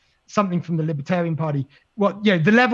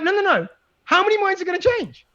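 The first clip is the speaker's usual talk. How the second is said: "How many minds are gonna change?" pitched much higher, pressing hard on "many"; exasperated insistence; 11 semitones higher than usual. Retold or imitated. Marketing guy presses the nonprofit colleague again, pitched up and exasperated. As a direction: hammer the question home, more exasperated.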